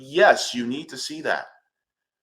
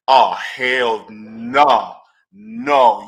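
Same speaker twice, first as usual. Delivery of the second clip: slow, loud, drawn-out refusal; loud drawn-out Oh, hell no; indignant hell no; 9 dB louder, much slower than usual. Real, not acted. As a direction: plant your feet and refuse.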